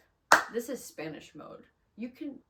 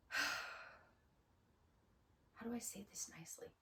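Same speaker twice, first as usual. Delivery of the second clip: very soft, whispery muttering; hushed aside; 10 dB quieter than usual. Real, not acted. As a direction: think aloud under your breath. Hesitant aside before harsh opinion.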